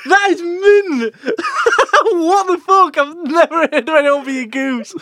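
high, loud delighted outburst; excited. Real, not acted. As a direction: shout with delight. Thrilled at getting a goose.

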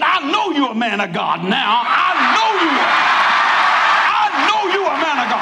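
husband shouting in relief; husband's outburst. Retold or imitated. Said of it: "He said".

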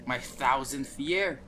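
ordinary talk, nothing marked

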